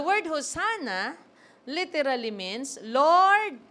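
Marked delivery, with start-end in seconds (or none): 2.8-3.6 a loud high call of Lord; crowd's cry. Acted. Crowd crying out to Jesus.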